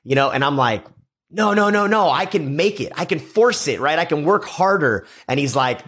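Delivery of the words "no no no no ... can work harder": high-pitched, pleading retold insistence; mock stubborn protest, pitched well up; insistent protest. Retold protest to mentor's objections.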